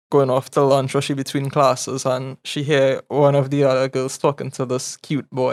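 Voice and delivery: ordinary delivery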